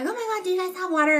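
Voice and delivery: high, fast, frantic begging; panicky plea. Retold or imitated. Stranded driver begging hikers for water, mock panic. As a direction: imitate a man freaking out over his car. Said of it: "So he's like".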